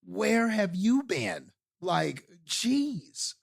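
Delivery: high, drawn-out disbelieving question; mock exasperation. Host voicing reaction to a newly discovered actor, mock exasperated. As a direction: stretch it out in exasperated amazement. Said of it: "It, it really felt like that".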